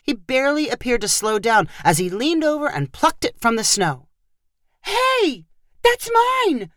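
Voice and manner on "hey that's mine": very high shrieking scream. A narrator's reading. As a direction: scream after the thief. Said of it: "Chloe screamed".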